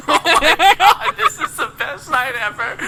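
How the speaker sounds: loud high slow squeal of joy; ecstatic. Real, not acted. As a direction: shriek with delight.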